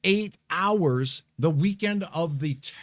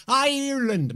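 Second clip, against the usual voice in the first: Ireland said in a bad, hammed-up Irish accent, pitched higher; a quick, deliberately bad Irish lilt on Ireland; 7 semitones higher than usual. Weatherman greeting Irish viewers in a mock Irish accent. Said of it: "My bad Irish accent".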